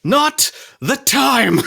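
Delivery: strained, laughing through clenched teeth; through gritted teeth. Acted. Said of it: "he says through gritted teeth".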